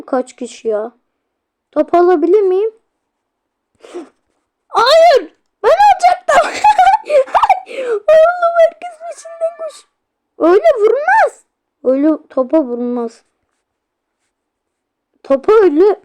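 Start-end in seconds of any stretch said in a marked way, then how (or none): 4.7-9.9 frustrated yell. Real, not acted. Goal snatched by teammate, yells. 10.4-11.4 "Öyle vurmaz" yelled high and loud; frustrated yell. Real, not acted. Gamer yelling at his Roblox team, loud and high. Complaining about the shot.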